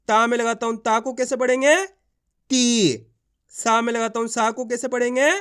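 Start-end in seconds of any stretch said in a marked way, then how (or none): 1.9-3.1 ती with a long, drawn-out ee. Shown as a demonstration. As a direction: stretch the vowel of ती for learners. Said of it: "खींच के पढ़ा जाता है".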